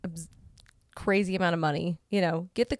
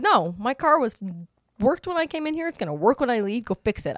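higher, louder firm demand; firm and mad; 5 semitones higher, 4 dB louder than usual. Retold or imitated. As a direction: lay down the law. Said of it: "I was so mad".

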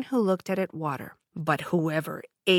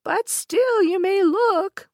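high, slow coaxing crone; old peddler woman; 13 semitones higher, 6 dB louder, slower than usual. A narrator's reading. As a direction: tempt her sweetly. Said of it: "said the old woman".

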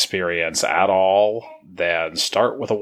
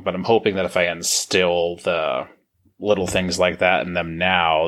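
ordinary talk

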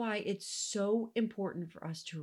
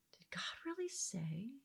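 a sly, doubting whisper; 9 dB quieter than usual. Acted. Teacher voicing the tempter's question, in a hushed whisper. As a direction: let the doubt creep in, barely voiced.

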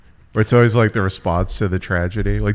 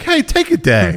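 mock boss; 14 semitones higher than usual. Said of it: "Whataburger was like".

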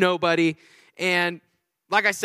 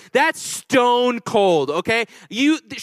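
ordinary talk

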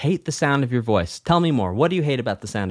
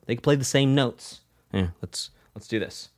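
normal talking voice

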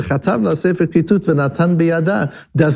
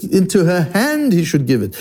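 "hand" lifted high, then the rest falling; emphasis on hand; 4 semitones higher than usual. Shown as a demonstration. Teacher translating the emphatic word order, stress on "hand". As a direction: lean hard on "hand", tail off after it. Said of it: "That's how you emphasize".

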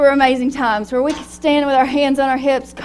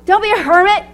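"Don't be a hermit" said high and firm, teasing; a teasing order, pitched up; playful command; 8 semitones higher, 5 dB louder than usual. Acted.

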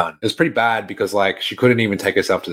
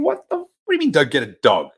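high-pitched, baffled retold question; mock disbelief; 7 semitones higher than usual. Reacting to grandma's no-dog rule, mock disbelief. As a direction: act out being thrown by the request.

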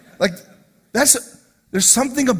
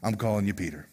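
soft casual Jesus; 10 semitones lower, 9 dB quieter than usual. Acted. Jesus voiced renaming Simon.

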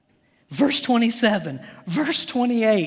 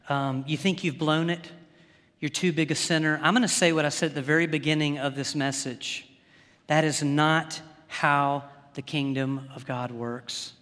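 gentle and tender; 6 semitones lower, 4 dB quieter than usual. Real, not acted. Preacher to a listener who thinks God gave up: soft, tender reassurance. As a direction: lower and softer, pastoral.

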